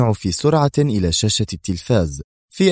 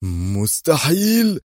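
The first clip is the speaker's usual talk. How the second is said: stunned cry; 2 semitones higher, much slower than usual. A narrator's reading. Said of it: "صائحًا".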